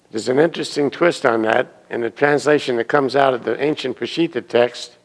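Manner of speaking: ordinary delivery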